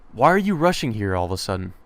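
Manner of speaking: ordinary delivery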